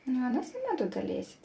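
ordinary talk

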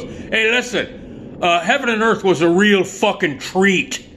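high, drawn-out sarcastic jab; Joe Donatello. Acted. Buddy insults director walking away, high sarcastic impression. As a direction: stretch the call, then needle him up high. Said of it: "and Joe goes".

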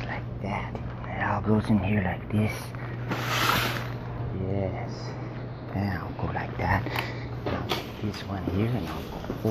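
हल्की फुसफुसाहट; whispering. Real, not acted. Quietly loading the dryer.

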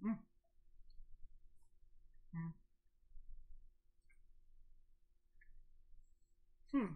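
humming with closed mouth; chewing food while murmuring; mouth full, chewing. Real, not acted. Seen 0: Lips closed, cheeks full, chewing sandwich bite throughout the mms.

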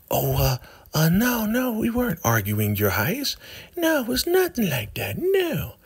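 high falsetto mock innocence; Joan. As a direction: deny it in a squeaky put-on voice. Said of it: "You know how she talks".

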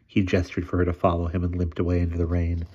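usual speaking voice

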